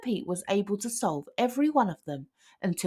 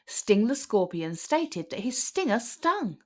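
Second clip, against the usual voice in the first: ordinary delivery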